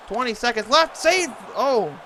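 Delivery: high, loud rapid-fire save call; excited. Real, not acted. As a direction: shout the save in the dying seconds. Frantic final seconds call.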